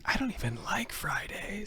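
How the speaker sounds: high whispered comic aside; Jim Gaffigan. Acted. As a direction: whisper it slowly, as a put-on complaining voice. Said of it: "That was my Jim Gaffigan impression".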